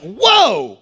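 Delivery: high, slow startled cry; startled Jesus. Acted. Preacher voicing Jesus noticing the touch, startled. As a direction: stop short and wonder aloud. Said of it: "He's like".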